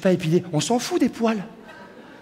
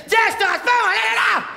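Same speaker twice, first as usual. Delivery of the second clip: high, loud wild yell; caveman shout; 10 semitones higher, 4 dB louder than usual. Acted. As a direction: bellow it like a brute pouncing.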